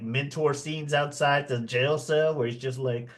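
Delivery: normal talking voice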